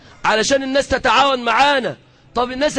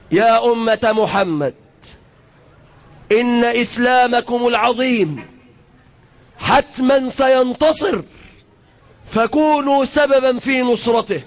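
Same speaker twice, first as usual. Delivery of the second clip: measured, drawn-out classical Arabic address; rallying speech from the pulpit, each phrase set apart; formal oratory; slower than usual. Acted. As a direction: proclaim it to the nation in stately phrases.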